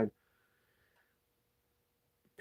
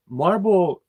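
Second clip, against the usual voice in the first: loud, high scoff at the word Marble; loud incredulous exclamation; exclaimed; 9 semitones higher, 12 dB louder than usual. Real, not acted. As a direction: blurt it out in disbelief. Mocking his own excuse.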